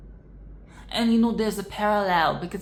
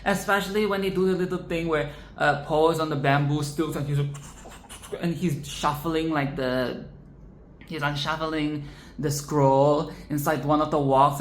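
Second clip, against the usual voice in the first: normal talking voice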